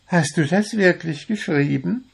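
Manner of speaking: high, astonished bird voice; surprised little bird. A narrator's reading. Little blue bird asks the dwarf about his writing, surprised. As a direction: ask in high-pitched disbelief. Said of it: "war der kleine blaue Vogel überrascht".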